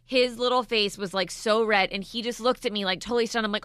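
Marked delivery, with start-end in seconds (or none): none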